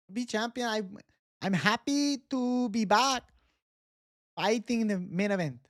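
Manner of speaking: put-on high, earnest Mexican accent, promising to be champion; Brandon Moreno impression. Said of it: "I can do the Baron de Moreno".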